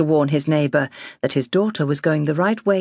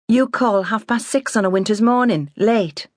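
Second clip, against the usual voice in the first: indignant retort, higher; snapping back; 7 semitones higher than usual. Acted. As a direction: snap back at her father. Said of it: "Cathy snapped".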